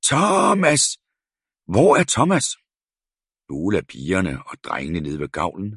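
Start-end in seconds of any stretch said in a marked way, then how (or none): 0.1-2.5 high, loud, frantic calling; screaming. A narrator's reading. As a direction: keep screaming for him. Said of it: "skreg fru Jensen".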